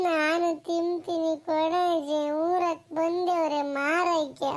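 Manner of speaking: put-on slow, tearful child's pleading; acted crying grandchild; whining cry. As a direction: whine and drag out every word like a begging child. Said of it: "ಯಾಕೆ ನಿನ್ ಮೊಮ್ಮಗ ಅಳ್ತಾ ಇದ್ದಾನೆ".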